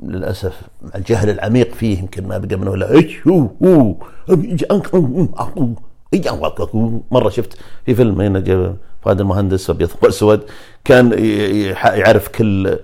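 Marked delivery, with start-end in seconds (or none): 2.9-6.7 mock Chinese. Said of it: "الصيني".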